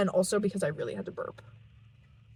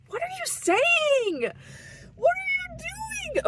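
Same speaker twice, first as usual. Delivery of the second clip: mock high voice. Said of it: "Okay, I gotta cut it".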